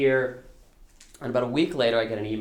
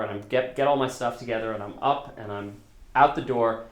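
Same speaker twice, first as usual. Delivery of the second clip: ordinary talk, nothing marked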